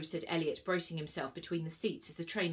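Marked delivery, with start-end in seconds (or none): none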